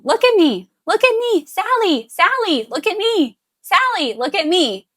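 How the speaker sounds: put-on high, loud, insistent calling of the child's name; repeated high calling. Shown as a demonstration. Parent calling a child who won't look, high and loud. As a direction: keep calling her high and loud, over and over. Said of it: "So if you're saying".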